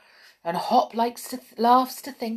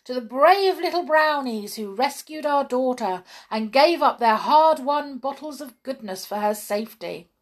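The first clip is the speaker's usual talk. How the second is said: higher, louder royal speech of praise; the king and queen hailing the heroes aloud; cried welcome; 5 semitones higher, 5 dB louder than usual. A narrator's reading. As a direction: proclaim it warmly to the brownies. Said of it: "they cried".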